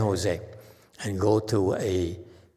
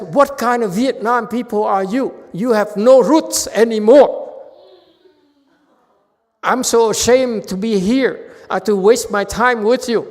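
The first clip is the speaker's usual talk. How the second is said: higher, louder indignant rebuke; upset scolding; 10 semitones higher, 9 dB louder than usual. Retold or imitated. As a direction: scold him as a hurt disciple. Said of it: "he was so upset".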